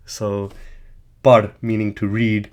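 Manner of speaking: normal talking voice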